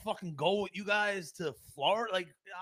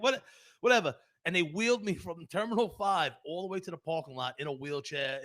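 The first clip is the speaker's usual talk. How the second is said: ordinary talk, nothing marked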